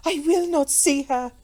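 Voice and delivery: high, loud, adamant refusal; insistent repeated refusal. A narrator's reading. Rigid Comtesse refuses to meet her enemy, adamant. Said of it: "She repeated mechanically".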